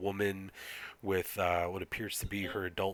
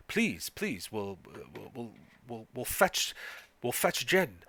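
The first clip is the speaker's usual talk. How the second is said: lightly voiced flustered villager, stammering; a touch higher, tripping over the offer to help; welcoming villager; 3 semitones higher than usual. Villager son offers travelers help, lightly flustered welcome. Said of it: "he says".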